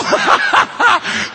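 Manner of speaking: high shouted burst of laughter. Real, not acted. Laughs after own joke.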